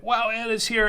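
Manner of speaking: high, loud wowed exclamation; excited reaction. Acted. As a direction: play up the thrill of a friend showing up. Mock-reaction to friend joining chat.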